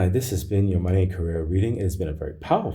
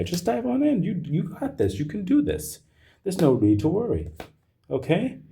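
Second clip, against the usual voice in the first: much higher, bright cheering on; high encouragement; 11 semitones higher than usual. Real, not acted. Reader encouraging Gemini to dive in, high cheering. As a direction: pump them up.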